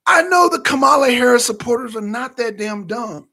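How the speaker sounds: ordinary talk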